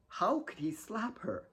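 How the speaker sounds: soft, hurt whisper; slapped girl whispering her shock. Acted. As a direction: breathe it out in disbelief. Kate's stunned inner thought.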